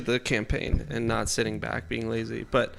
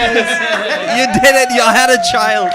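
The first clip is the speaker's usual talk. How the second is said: put-on high, very loud accented congratulations; Morjo; 11 semitones higher, 13 dB louder than usual. Player congratulates new parents in character, loud accented cheer. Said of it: "can you do the Morjo impression".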